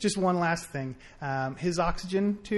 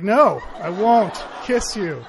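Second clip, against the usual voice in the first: slow, louder refusal through laughter; laughing refusal; 2 semitones higher, 10 dB louder, slower than usual. Retold or imitated. Answering Patrick's kiss request, laughing and louder. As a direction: turn him down while laughing.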